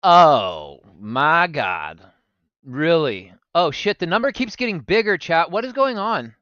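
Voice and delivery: loud startled gasp-like reaction; real amazed disbelief; surprised. Real, not acted. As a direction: react to the growing viewer count. Viewer count keeps rising.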